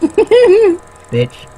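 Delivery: a sudden very high squeak of Bitch; squeaky mock insult. Co-host teasing the reader, loud high squeak. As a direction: squeal the word like a cartoon.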